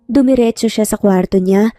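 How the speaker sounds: ordinary delivery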